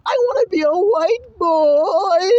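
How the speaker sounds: high, drawn-out fake bawl; hammed-up tearful whine, last word stretched; mock crying child. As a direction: wail like a little boy in tears. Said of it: "he's crying".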